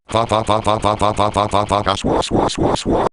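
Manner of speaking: rapid looped "fa" turning into airy swooshes; fa glitch. Shown as a demonstration. First of two examples, rapid fa glitch into swooshes. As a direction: stutter "fa" mechanically, then break into "swa" noise. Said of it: "you actually have to conjoin fa".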